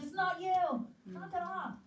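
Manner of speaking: put-on teacher firmly telling the boys off; firm rebuke. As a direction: snap the boys out of it.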